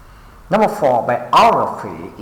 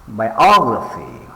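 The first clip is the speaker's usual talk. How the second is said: biography with OG punched, then falling; bi-OG-raphy, leaning on OG; stress on second syllable; 4 dB louder than usual. Shown as a demonstration. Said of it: "pay attention to the stress syllables".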